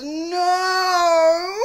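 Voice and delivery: high anguished no; anguished cry. Retold or imitated. Fan reacting to Damon's line, a high crying no. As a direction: let your heart break on one word. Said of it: "my heart just goes".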